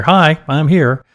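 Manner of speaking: drawn-out, sing-song hello from a late joiner; mock cheery late arrival. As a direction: play the oblivious latecomer announcing himself. Imitating a late caller's announcement.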